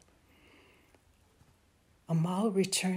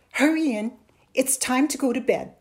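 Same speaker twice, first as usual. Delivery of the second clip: louder, higher, impatient mother; 5 semitones higher, 8 dB louder than usual. A narrator's reading. Mother sends her son in to bed, impatient. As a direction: hurry a child along impatiently. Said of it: "his mother sounded impatient".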